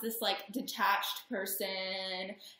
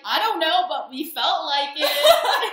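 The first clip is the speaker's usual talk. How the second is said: mock careless reply; 6 semitones higher, 11 dB louder, slower than usual. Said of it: "And they'll be like".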